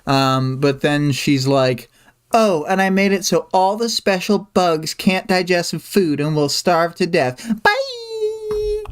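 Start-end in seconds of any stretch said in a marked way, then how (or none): 2.3-8.8 higher-pitched, gleefully petty quote; hammed-up parting taunt; mock breezy spite. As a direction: toss off the threat, ending in a very high sing-song bye. Mocking acted quote of Amy's taunt.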